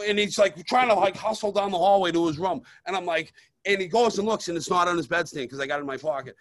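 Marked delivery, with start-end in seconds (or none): none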